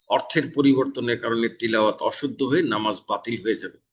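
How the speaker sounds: usual speaking voice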